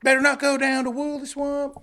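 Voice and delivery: high, loud eerie warning; high spooky storyteller warning. Retold or imitated. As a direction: warn them off the swamp. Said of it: "Southern horror story".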